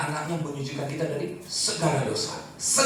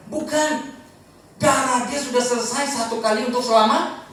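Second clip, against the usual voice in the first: ordinary talk, nothing marked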